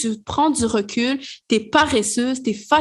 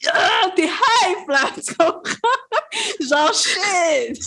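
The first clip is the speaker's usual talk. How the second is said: excited laughing; 9 semitones higher, slower than usual. Real, not acted. Excited laughing about good vibes.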